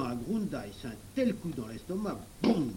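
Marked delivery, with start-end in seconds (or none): none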